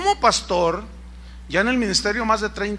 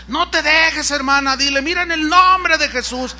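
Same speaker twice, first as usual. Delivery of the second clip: higher, louder urging; higher, louder egging on; gossiping commenter; 5 semitones higher, 4 dB louder than usual. Retold or imitated. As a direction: fire her up to confront him. Commenter urges the wife.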